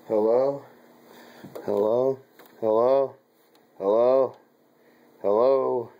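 loud repeated hellos, getting insistent; impatient mic check. Real, not acted. Mic still failing, calling again.

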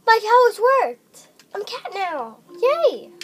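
high, loud triumphant cheer; excited cheer. Acted. Gamer cheers after turning into a cat. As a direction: cheer the spell working.

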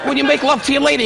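ordinary talk, nothing marked

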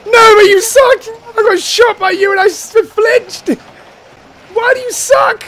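high, shouted accusation; outraged protest. Real, not acted. Player shot by teammate Nova, high outraged shouting. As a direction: wail the complaint at a teammate.

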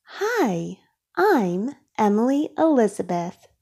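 plain reading voice

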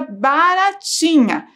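baratinha drawn out slowly, syllable by syllable; slow syllable reading. Shown as a demonstration. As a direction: sound out baratinha for the class. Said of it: "retirar o início da palavra".

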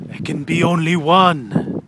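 loud, slow epic movie declaration; Highlander impression. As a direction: boom it out like a movie hero. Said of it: "Come on, do your Scottish accent".

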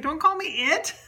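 acted-out comic indignation; mock offence. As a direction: protest the label in jest. Spirit objects to being called it.

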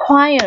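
"choir" starting with a kw sound; pronunciation demo. Shown as a demonstration. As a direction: model "choir" as kwire for learners. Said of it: "C H ở đây được phát âm là kh".

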